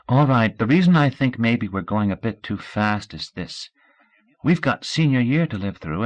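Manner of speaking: steady and sensible, reasoning with her; earnest young Scout. Acted. Scout reasons with Audrey about their future, earnest.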